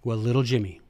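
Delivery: ordinary talk, nothing marked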